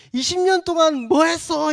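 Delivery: 훨씬 높고 크게 따지듯; 솔로몬을 나무라듯 크게 외치기; 답답한 꾸짖음. Retold or imitated. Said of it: "이런 마음으로 보라는 거죠". (솔로몬에게 따지는 독자, 높고 큰 꾸짖음)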